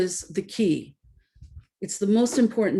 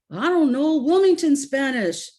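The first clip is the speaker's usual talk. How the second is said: dad's retort; 6 semitones higher, 5 dB louder than usual. Retold or imitated. Dad answering daughter.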